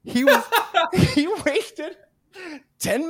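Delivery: high laughing disbelief; words pushed through laughter. Real, not acted. Telling friend the prank, laughing high. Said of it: "laughing at the memory".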